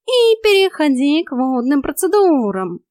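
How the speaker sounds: high, loud, drawn-out instruction; concluding cat coach. A narrator's reading. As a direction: announce the next stage triumphantly. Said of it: "заключил кот".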